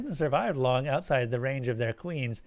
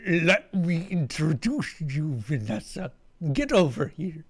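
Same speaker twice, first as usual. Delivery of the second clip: alien creature voice; 2 semitones higher than usual. Acted. Alien speaks in his voice.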